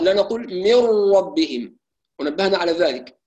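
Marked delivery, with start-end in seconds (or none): 0.5-1.8 من ربهم with the lips wrongly rounded at the ra. Shown as a demonstration. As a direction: show the error, lips pushed round. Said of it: "لا نضم الشفتين عند الراء". (من ربهم بضم الشفتين خطأً)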